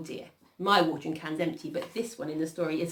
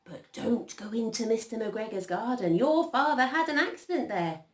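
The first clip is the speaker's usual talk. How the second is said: high, emphatic motherly warning; mother rabbit; 6 semitones higher, 5 dB louder than usual. A narrator's reading. Mrs Rabbit warning of McGregor's garden, high motherly voice. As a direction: warn the children off with big rising swoops. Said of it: "said old Missus Rabbit one morning".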